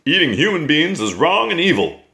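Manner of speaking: high, bouncy voice of a kindly giant; friendly giant. A narrator's reading. BFG reprimands.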